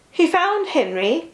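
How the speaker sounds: usual speaking voice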